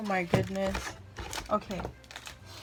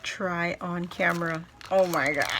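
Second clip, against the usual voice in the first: ordinary talk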